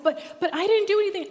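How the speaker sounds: put-on a kid pleading his innocence; protesting child. Noah pleading with the teacher, high fast protest.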